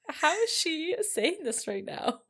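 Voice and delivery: high-pitched laughing disbelief. Real, not acted. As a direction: laugh through the words.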